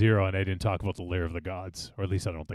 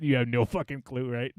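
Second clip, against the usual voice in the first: normal talking voice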